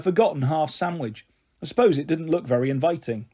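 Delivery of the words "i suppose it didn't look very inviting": usual speaking voice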